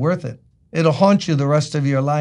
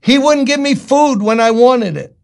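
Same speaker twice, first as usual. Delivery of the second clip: put-on higher, louder childish protest; mocking an overblown grievance; mock petulant outrage; 6 semitones higher, 5 dB louder than usual. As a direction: whine it like a hammed-up tantrum. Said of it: "David now is gonna have blood running like a river".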